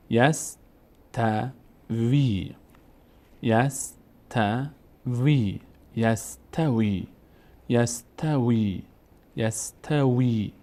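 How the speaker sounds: "یستوی" slowly as yas-ta-vee, final ee held; syllabled, long ending. Shown as a demonstration. Teacher reading the exercise word, slow "یستوی" with long ee. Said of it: "اینجا الان صدای کشیدهٔ ای".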